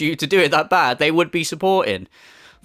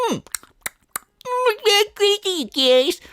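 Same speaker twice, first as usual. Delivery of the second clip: squeaky, much higher mock voice with mouthful hums; mocking munching streamer; much slower than usual. Mocking the streamer stuffing his face for viewers, squeaky and high. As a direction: lampoon him savouring a burger, pitched way up. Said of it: "eat some burgers and go".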